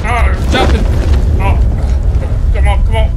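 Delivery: yelling to the sergeant after losing the gun; loud panic. Acted. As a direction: keep yelling in a fluster. Lost gun, jumping in dark.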